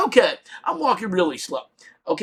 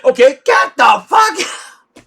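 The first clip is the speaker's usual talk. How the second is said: slow, loud, strained yell; mock panicked shout; 5 semitones higher, 7 dB louder, slower than usual. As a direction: give in and scream it. Building guys finally yelling.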